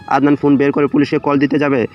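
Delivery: ordinary delivery, nothing marked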